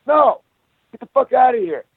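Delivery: mock yell chasing off the thief; retold angry yell. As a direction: snap the beggar out of the door. Throwing the beggar out.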